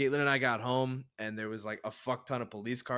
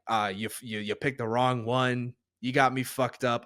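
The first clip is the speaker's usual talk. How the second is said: ordinary talk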